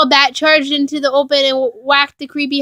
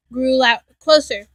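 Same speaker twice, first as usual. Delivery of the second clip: usual speaking voice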